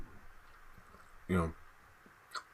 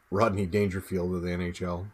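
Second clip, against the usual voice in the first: ordinary talk, nothing marked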